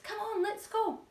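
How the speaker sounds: high, eager urging; rally the group to go; eager call. A narrator's reading. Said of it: "they chorused".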